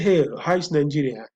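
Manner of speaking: normal talking voice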